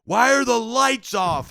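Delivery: high, very loud, drawn-out holler; confused old man. Retold or imitated. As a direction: bellow it like a bewildered old-timer. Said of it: "the old man goes".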